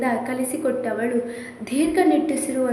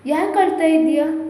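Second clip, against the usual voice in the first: plain reading voice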